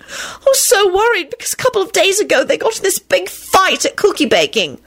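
high, overwrought wail; tearful bawling. A narrator's reading. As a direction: pour it out tearfully, pitch way up. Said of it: "Rosie bawled out".

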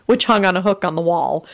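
usual speaking voice

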